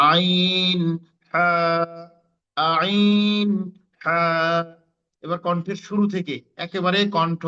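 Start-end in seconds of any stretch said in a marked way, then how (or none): 0.1-4.2 আইন squeezed from the middle of the throat, হা breathy; mid-throat letters demo. Shown as a demonstration. Teacher drills আইন and হা for students, squeezed. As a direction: press আইন from mid-throat, then a clean হা. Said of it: "কণ্ঠনালীর মধ্যখান হতে দুটি হরফ উচ্চারিত হয়".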